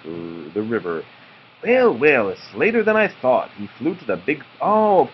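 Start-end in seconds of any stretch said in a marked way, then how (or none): 1.6-3.5 blue jay voice. Acted. Blue jay speaks in story.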